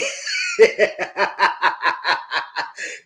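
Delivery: a burst of laughter, falling away; real laugh. Real, not acted. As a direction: laugh at your own rant. Laughs at own rant.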